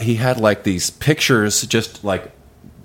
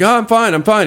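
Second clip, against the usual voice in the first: panicky reassurance; 13 semitones higher, 4 dB louder than usual. Retold or imitated. Faint, scared, covering it up.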